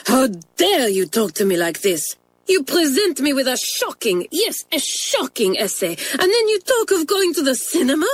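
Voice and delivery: put-on indignant French-accented tirade, climbing high on cinema; Mamselle furious. French mistress forbidding the cinema trip, angry. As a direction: scold her down hard. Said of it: "raged Mamselle".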